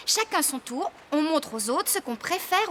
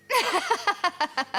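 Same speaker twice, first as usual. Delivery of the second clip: light laugh; 5 semitones higher than usual. Acted. Flore laughs at the sausages.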